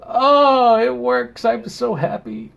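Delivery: high, happy relieved voice; giddy that the project works; overjoyed. Real, not acted.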